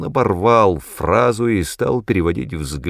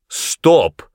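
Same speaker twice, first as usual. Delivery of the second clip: higher, sharp shouted halt; raised-voice order to stop; shouted command; 7 semitones higher, 4 dB louder than usual. A narrator's reading. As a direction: cry out to demand silence. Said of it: "крикнул Аас".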